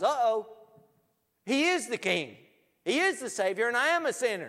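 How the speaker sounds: high, loud acted panic; preacher crying out a sinner's dismay; alarmed realization. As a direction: blurt it out as a terrified confession.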